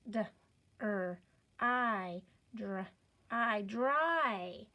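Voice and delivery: "D, er, I" as separate sounds, blended into "dry"; sounding out. Shown as a demonstration. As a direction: break it into "dr" and "I", then join as "dry". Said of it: "go back and touch our sounds".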